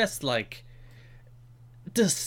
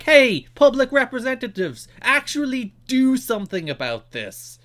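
mock demand; 10 semitones higher, 4 dB louder than usual. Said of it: "instead of being like".

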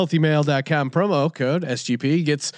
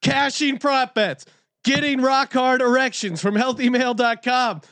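jacked-up boast; 6 semitones higher than usual. Acted. Host boasting he is jacked up on meat, high hype. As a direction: hammer each boast like a pumped-up pitchman.

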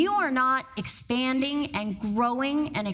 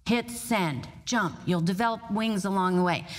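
ordinary delivery

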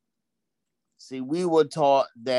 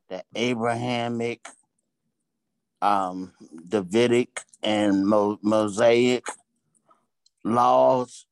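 ordinary talk

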